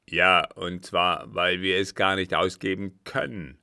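loud, forceful angry answer; angry retort. A narrator's reading. Captain breaking bad news to the count, angry. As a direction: hit back hard and loud.

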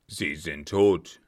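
low, loud, slow retort; menacing captain. Acted. Captain dismissing the baroness's witnesses, menacing. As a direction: pronounce it with heavy finality.

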